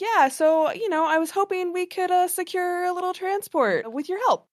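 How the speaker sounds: high-pitched, tentative in-character ask; in-character request. Acted. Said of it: "kind of be like".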